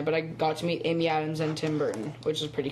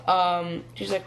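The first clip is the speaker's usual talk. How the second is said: usual speaking voice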